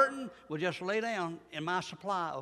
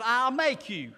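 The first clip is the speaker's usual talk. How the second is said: firm, higher, louder divine command; voice of God; 6 semitones higher, 7 dB louder, much slower than usual. Acted. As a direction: declare it as the Lord, firmly. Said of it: "and He says".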